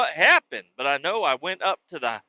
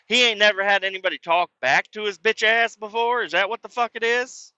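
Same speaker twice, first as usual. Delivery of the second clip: indignant mocking retort; 6 semitones higher than usual. Arguing with mom on phone.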